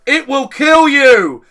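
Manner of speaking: high, slow, loud warning shout; lizard brain yelling. Pretend. Lizard brain warns you about the spider, slow loud shout. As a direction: bellow the warning slowly. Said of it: "lizard brain kicks in and goes".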